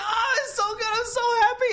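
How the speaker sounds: very high, overjoyed mimicked father; excited Chinese-accented dad. Dad on the phone after the commercial, overjoyed, high accented voice. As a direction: gush as a Chinese dad thrilled for his son. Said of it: "he's never this excited".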